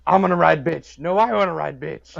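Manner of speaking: loud, bossy biker voice, hammed up; rough tough-guy shout. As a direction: bark it like a greedy biker. Voicing bikers fighting over ride.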